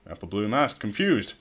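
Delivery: usual speaking voice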